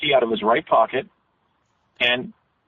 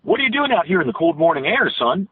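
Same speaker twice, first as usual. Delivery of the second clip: higher, lilting eastern Pennsylvania accent; sing-song old-time Pennsylvania dialect; sing-songy rural Pennsylvania; 5 semitones higher than usual. Shown as a demonstration. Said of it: "it kinda had a sing-songy quality".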